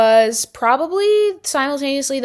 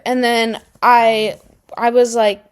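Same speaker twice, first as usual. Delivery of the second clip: usual speaking voice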